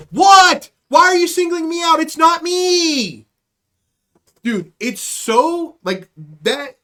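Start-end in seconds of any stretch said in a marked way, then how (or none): 0.1-3.3 loud high hammed-up protest; mock indignant shout. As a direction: protest innocence way too loud.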